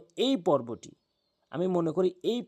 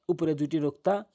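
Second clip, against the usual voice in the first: normal talking voice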